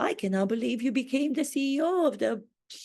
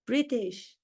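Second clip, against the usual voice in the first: ordinary delivery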